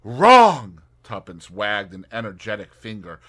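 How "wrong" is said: emphatic wrong. Acted. Tuppence contradicting Tommy.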